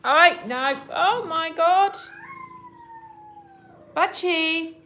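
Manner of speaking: startled cry as the cats kick off; alarmed outburst. Real, not acted. Catching Butchie attacking Betty with a loud, high alarmed cry.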